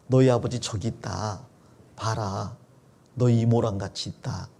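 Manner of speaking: put-on low, soft aside to the children; subdued mother. Leah pointing out father to her children, subdued. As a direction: speak quietly, voice dropped low.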